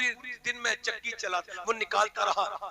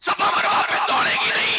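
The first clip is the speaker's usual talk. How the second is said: loud, high-pitched sermon shouting; full-force pulpit preaching, rising to shouts; fiery preaching; 12 semitones higher, 12 dB louder than usual. Acted. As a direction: thunder it at the congregation.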